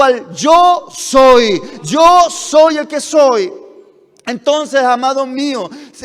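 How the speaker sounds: loud, emphatic sermon voice building up; full-force preaching. Acted. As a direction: preach it out to the congregation.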